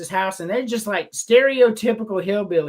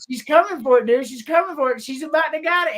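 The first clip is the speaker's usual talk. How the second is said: higher-pitched, worked-up running commentary; excited retelling; 7 semitones higher than usual. Retold or imitated. Boys warning their friend in the film, excited retelling. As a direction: build the mock alarm as she closes in.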